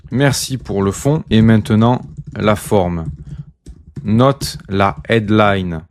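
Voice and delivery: slow, drawn-out dictation, word by word; dictating while typing. Real, not acted. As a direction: say it slowly as you type it out.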